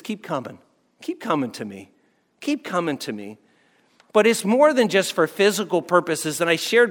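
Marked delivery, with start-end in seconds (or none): 0.0-3.4 gentle invitation. Retold or imitated. Jesus gently calls his people to keep coming. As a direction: lower and much softer, tender.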